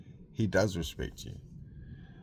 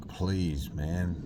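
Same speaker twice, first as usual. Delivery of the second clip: usual speaking voice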